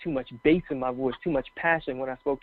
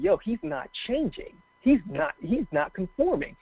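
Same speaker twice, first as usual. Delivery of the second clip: impressed doubters; 6 semitones higher than usual. Retold or imitated. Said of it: "for them to be like".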